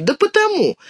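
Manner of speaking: put-on high, huffy child's retort; indignant girl, pitched high. A narrator's reading. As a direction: snap back with pitch leaping up.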